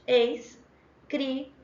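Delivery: "escrivaninha" cut into separate syllables with pauses. Shown as a demonstration. Teacher reading the alternatives, "escrivaninha" split into separate syllables. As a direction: say "es, cri, va, ni, nha" one at a time. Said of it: "a gente tem aí as alternativas".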